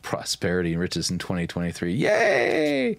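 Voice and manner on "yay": high mock cheer, a sarcastic yay. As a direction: cheer shrilly, not meaning it.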